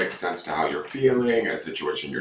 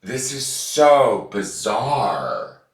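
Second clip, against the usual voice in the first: slow, higher, stunned disbelief; real amazed disbelief, slow and slightly raised; dumbfounded; 5 semitones higher, 4 dB louder, much slower than usual. Real, not acted. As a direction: drag it out, still reeling from the card. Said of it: "I'm completely dumbfounded".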